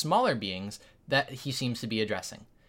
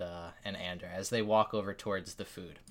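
normal talking voice

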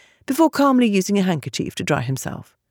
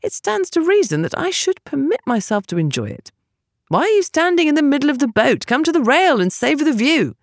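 Finn's voice; 7 semitones higher than usual. Acted. Finn, a calm aristocrat, speaking.

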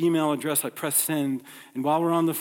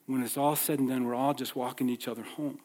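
ordinary talk

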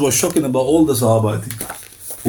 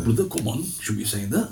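low, hushed, offended reply; a quiet, low, wounded film-watcher; offended brother; 6 semitones lower, 10 dB quieter than usual. Acted. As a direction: mutter it, put out.

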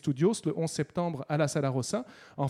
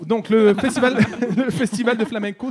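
laughing, much louder and higher; 7 semitones higher, 12 dB louder than usual. Real, not acted. Radio guest getting back to the festival, laughing. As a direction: push through a real laugh back to business. Laughs while resuming the topic.

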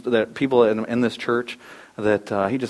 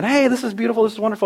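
high, lilting wonder; gushing over the view; mock-blissful higher voice; dreamy admirer; 10 semitones higher than usual. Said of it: "I'm out there saying".

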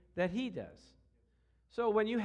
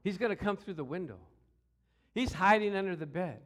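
normal talking voice

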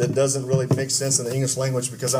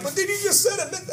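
much higher, incredulous squeak. Retold or imitated. Student to English teacher, high incredulous squeak. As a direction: squeal it like you can't believe it. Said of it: "and I'm like".

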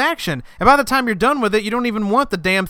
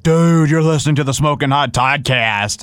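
lower, laid-back cool-guy read; cool dude voice; 6 semitones lower than usual. Shown as a demonstration. Mocking how not to read the promo, low cool dude. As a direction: say it like you think you're cool. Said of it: "Don't say it some way cool".

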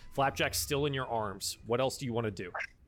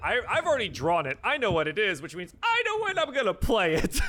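teasing; 7 semitones higher, 5 dB louder than usual. Real, not acted. GM teasing players about card.

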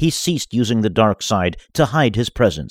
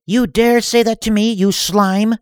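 much higher, louder outraged voice; be insulted and outraged; offended villainess; 4 dB louder than usual. Acted.

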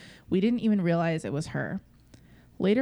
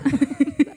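laugh; 8 semitones higher, 7 dB louder than usual. Real, not acted. Laughs at own accent attempt.

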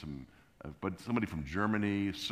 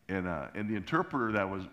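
normal talking voice

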